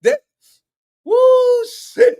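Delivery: high, loud woo of shock; hyped. Real, not acted. Reacting to a bold rant, loud hype. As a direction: let it burst out.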